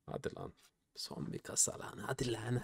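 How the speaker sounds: barely voiced, tense hush; hushed plea. Real, not acted. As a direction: breathe the words, willing it to happen. Hushed plea before the last case.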